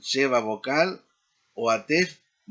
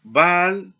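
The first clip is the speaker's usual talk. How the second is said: loud, raised opening "Ba" in "Baal"; first-syllable stress; 4 semitones higher, 9 dB louder than usual. Shown as a demonstration. Teacher giving an example for learners, first-syllable stress on Baal. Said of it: "el énfasis en la primera sílaba".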